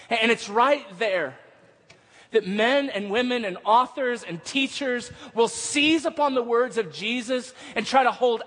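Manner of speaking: high-pitched, emphatic preacher's proclamation; impassioned preaching. Real, not acted. Preacher warning of authors who seize upon Jesus's words, impassioned. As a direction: drive each phrase home to the congregation.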